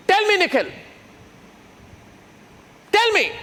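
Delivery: high, insistent challenge; pressing demand. Real, not acted. Host demanding Nikhil answer, high and insistent. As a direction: corner him, demand a reply.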